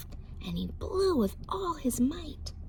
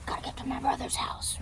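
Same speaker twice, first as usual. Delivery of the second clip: fast whispered pig; fleeing pig; panicked piggy; 4 semitones higher, 3 dB quieter, much faster than usual. Acted. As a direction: rush it under breath. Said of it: "So the piggy ran".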